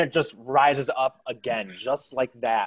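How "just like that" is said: ordinary talk, nothing marked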